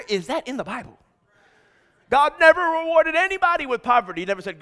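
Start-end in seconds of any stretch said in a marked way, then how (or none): 2.1-4.2 high, loud pulpit proclamation; raised, insistent preacher's voice; emphatic preaching. Real, not acted. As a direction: proclaim it forcefully to the whole room. Preacher's emphatic claim to congregation.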